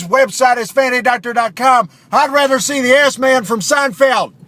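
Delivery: loud, fast indignant ad voice; angry Steve. Acted. Radio ad Steve snapping at Phil, fast and loud. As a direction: rant at your buddy, fast and loud.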